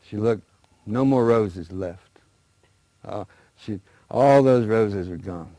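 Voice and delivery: ordinary delivery, nothing marked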